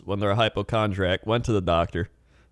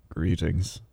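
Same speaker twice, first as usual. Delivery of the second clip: lower, softer, flat "Greetings"; glum greeting; 4 semitones lower, 4 dB quieter than usual. Shown as a demonstration. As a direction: greet flatly, with no interest. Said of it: "Or you could be like".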